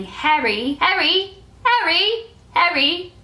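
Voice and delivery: high-pitched British "Harry", repeated; British accent. Retold or imitated. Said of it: "in a British accent".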